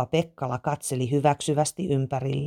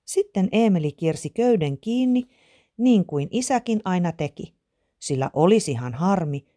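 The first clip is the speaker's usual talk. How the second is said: ordinary delivery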